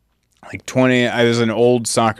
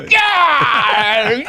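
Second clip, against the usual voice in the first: excited yell. Retold or imitated. Celebrating with nephew after his goal, shouting. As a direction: yell it like he just scored. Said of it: "I, I'm like".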